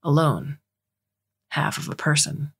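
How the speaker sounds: understated despair; hopeless. A narrator's reading. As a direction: let each short phrase sink, dejected. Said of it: "like he just lost all hope".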